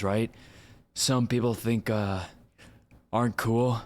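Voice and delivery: animated, slangy dude storyteller; hyped-up retelling, pitch lifting on the wild parts; Aaron Paul. Acted. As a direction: Jesse Pinkman-style delivery. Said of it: "actually do sound like Aaron Paul".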